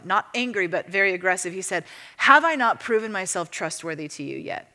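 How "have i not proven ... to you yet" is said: normal talking voice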